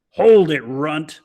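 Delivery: loud snarled order; snarling. A narrator's reading. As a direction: snarl it at the runt. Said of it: "snarled McNabb".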